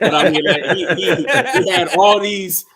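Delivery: loud, high-pitched, breaking into a laugh. Real, not acted. Others laughing at his joke.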